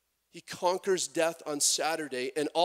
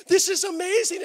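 much higher and louder, thrilled; wife excited; 14 semitones higher, 10 dB louder than usual. Retold or imitated. Wife thrilled about Palm Sunday, much higher and louder. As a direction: gush like she just heard great news. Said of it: "she's all excited".

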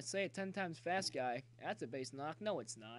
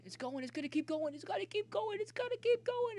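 climbing from raised voice to high shout; will the ball over, each repeat higher and louder; mounting excitement; 6 dB louder than usual. Real, not acted. Batter's player urging the fly ball on, building excited shout.

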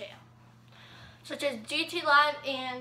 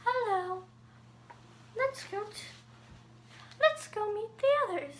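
high-pitched motherly goat character; lightly delivered high voice for a mom character; 8 semitones higher, 4 dB quieter than usual. Acted. Host voices Goat Mom from Undertale, high-pitched character impression. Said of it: "Goat Mom".

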